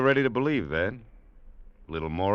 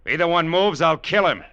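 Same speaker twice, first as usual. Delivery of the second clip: loud, high deadly warning; loud threat; 9 semitones higher, 10 dB louder than usual. Acted. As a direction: threaten them at full volume. Threatening the gunmen at gunpoint.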